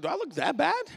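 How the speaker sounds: high, loud, incredulous question; mock incredulous. Preacher retelling his reply to kids seeking drugs, mock incredulous. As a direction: pitch it up in comic disbelief. Retold shock at being offered drugs.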